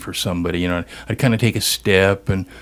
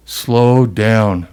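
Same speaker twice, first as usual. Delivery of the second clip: slow, louder, drawn-out command; wife's voice in the dark; 2 semitones higher, 4 dB louder, much slower than usual. Retold or imitated. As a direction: imitate the sleepy wife telling him to stop. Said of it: "I would hear this voice in the dark".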